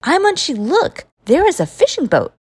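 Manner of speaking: eager call, starting high on the name; excited. Acted. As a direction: point out the boat with a child's thrill. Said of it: "patting Imanchi excitedly".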